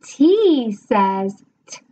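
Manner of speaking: very slow letter sound; slow phonics model. Shown as a demonstration. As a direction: stretch it out for the child. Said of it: "I count as they say".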